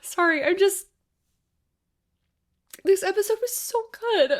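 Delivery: very high, squeezed crying voice; tearful. Real, not acted. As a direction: squeak it out through real tears. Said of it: "I'm actually tearing up right now".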